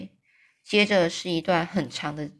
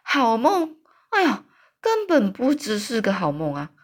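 a giant's startled shout, pitched up; 3 semitones higher, 3 dB louder than usual. A narrator's reading. Giant shouts back at Sophie's question, loud. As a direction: cry out the words in disbelief. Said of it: "这个巨人他大叫说".

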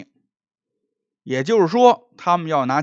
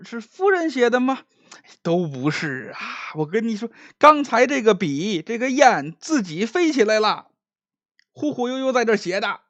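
spooked magistrate; 7 semitones higher than usual. Acted. Scared magistrate explains flying brush.

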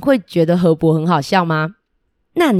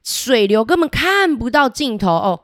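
ordinary delivery, nothing marked

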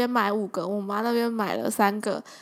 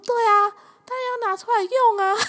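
much higher, louder, laughing coworker voice; 11 semitones higher, 5 dB louder than usual. Retold or imitated. Coworker laughing that of course he uses it. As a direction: answer with gleeful obviousness.

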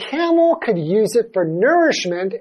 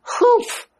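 squeaky, much higher huff; high huffy humph. Retold or imitated. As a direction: huff like a stubborn camel. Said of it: "So he's like".

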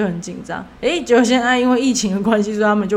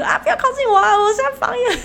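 much higher, hammed-up shriek of alarm; mock shriek. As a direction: squeal like a coworker dodging a pushy seller. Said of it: "然后他就在一直尖叫". (模仿同事尖叫躲开，音调极高)

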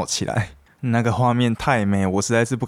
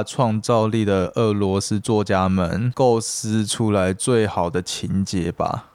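put-on slow, slurred drunk rambling; tipsy barroom musing; slower than usual. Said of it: "模仿一个喝醉酒的人".

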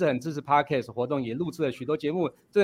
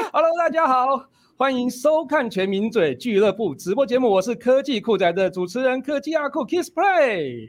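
show host intro; 7 semitones higher, 4 dB louder than usual. Acted. Host opens the live show.

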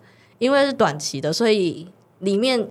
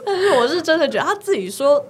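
laughing; 8 semitones higher than usual. Real, not acted. Laughs at joke.

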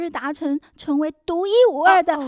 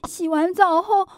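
normal talking voice

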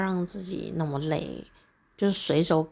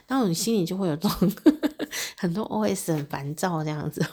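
ordinary talk, nothing marked

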